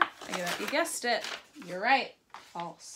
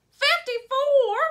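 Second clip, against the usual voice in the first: very high, loud and slow squeal; loud silly voice; 11 dB louder, slower than usual. Shown as a demonstration. Teacher modeling a loud silly number call for the class. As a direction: shriek the number out goofily. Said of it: "your silly voice ready or your loud voice ready".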